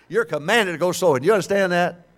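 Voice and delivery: booming repeat to the congregation. Real, not acted. Preacher repeats louder to congregation.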